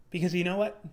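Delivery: normal talking voice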